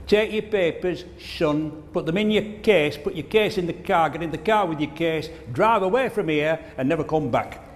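put-on an old Yorkshireman dismissing a salesman, gruffly. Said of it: "'cause that's how they talk in Yorkshire".

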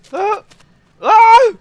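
very high, loud scream of fright; startled scream. Real, not acted. Player hit by a jump scare, screaming. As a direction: scream twice, the second bigger.

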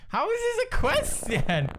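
high-pitched exasperated protest; incredulous outcry. Real, not acted. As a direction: cry out in total disbelief. Baffled by absurd question.

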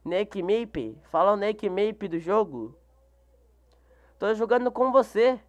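ordinary delivery

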